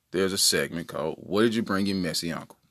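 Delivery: ordinary talk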